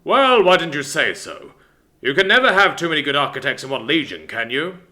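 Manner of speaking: booming father voice. Acted. Father pleased.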